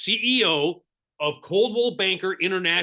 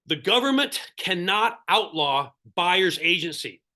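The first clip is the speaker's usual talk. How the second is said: ordinary talk, nothing marked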